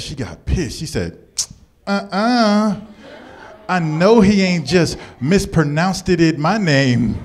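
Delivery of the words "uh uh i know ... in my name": offended sassy woman. Retold or imitated. Offended woman correcting him.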